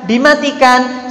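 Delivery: ordinary talk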